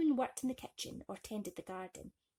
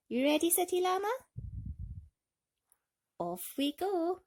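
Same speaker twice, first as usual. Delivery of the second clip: high, bright cheer; excited; 10 semitones higher, 5 dB louder than usual. Real, not acted. Asking City Llama if he's ready, excitedly. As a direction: pump up the llama for the story.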